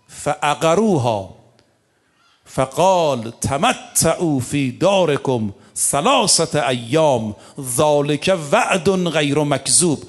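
slow, drawn-out chanted recitation of the verse; Quran recitation. Real, not acted. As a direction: intone the Arabic verse slowly and solemnly.

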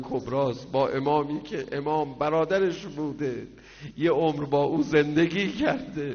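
building from speech to a loud, high wail; rising lament. Acted. Preacher rousing mourners with a rowzeh, building loud. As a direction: swell into the mourning chant.

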